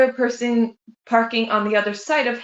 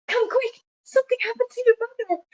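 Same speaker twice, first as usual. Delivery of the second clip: high, fast, urgent alarm from a stranger at the door; breathless high-pitched call for help; frantic stranger; 14 semitones higher, faster than usual. Retold or imitated. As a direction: blurt it out high and fast like a panicked neighbor. Stranger at door, mother fell.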